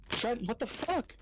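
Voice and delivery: high, drawn-out imitation of disbelief; retold shocked outcry. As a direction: imitate an appalled friend, pitch way up. Retold friend's shocked outcry at Trent.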